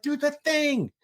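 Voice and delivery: a high, teasing mom's demand; insisting mom. Retold or imitated. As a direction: coax him like a mother who won't take no.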